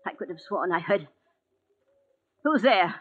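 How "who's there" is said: higher, louder startled cry; frightened call. Acted. Alone invalid hearing a noise, frightened. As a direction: call out afraid into the dark. Hears someone behind her.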